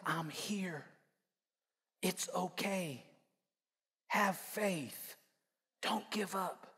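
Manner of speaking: God consoling Elijah in a soft hush; soft whisper. Acted. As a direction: breathe it gently like a whisper. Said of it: "he sends a whisper".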